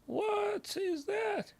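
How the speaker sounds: very high, slow falsetto squeak; squeaky disbelief. Real, not acted. Streamer squeaking in disbelief at the opponent's move.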